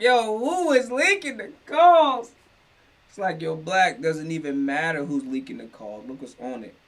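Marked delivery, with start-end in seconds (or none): 0.0-1.9 high, loud and slow, demanding to know who did it; exasperated outburst. Retold or imitated. Reacting to the leaked jail calls, high loud exasperated demand. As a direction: throw the question out in disbelief. Outraged rhetorical question on leaks.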